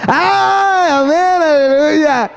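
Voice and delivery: high, ringing praise shout; mock jubilant amen cry; excited cry. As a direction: burst out in exaggerated praise. Congregation cheering mock response.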